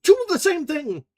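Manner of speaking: high, loud accusing cry; sharp accusation. A narrator's reading. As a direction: fling the words at her. Ferguson accusing.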